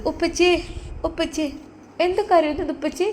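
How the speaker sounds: put-on a small girl worried for her dad; little girl. Little daughter asking her crying father why, high and soft.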